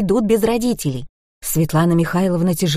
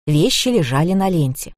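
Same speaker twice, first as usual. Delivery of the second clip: plain reading voice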